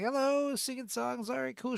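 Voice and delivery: mock self greeting the karaoke girl; chirpy greeting. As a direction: play it as a nervous friendly squeak. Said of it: "being like".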